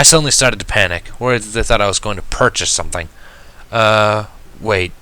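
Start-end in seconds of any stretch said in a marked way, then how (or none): none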